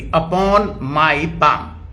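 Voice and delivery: upon my palm drawn out very slowly; very slow emphasis. Shown as a demonstration. Preacher illustrating upon with a watch, very slow. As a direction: stretch each word as you show the watch. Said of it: "जैसे ये घड़ी मैंने".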